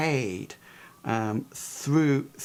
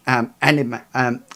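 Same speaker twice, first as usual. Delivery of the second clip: ordinary talk, nothing marked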